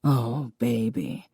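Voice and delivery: low, soft and slow, voice wobbling; a father barely holding it together; father answering his daughter's pain, shaky and soft. Acted. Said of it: "his voice wobbling".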